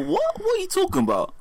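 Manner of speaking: high-pitched incredulous squeal; shocked disbelief. Pretend. Mock disbelief at "fifa and chill.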